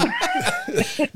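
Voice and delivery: squeaky high laugh. Real, not acted. Host cracking up after the golf joke, high laugh. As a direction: burst into a high giggle.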